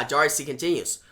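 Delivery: ordinary talk, nothing marked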